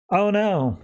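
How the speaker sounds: high-pitched feigned dismay; mock alarm. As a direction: say it as a playful, fake oh-no. Mock alarm at predictable threat.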